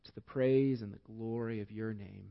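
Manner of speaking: low, soft, measured prayer voice; hushed, reverent public prayer, sinking to a soft Amen. Real, not acted. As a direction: pray quietly, pausing between phrases. Leads closing prayer.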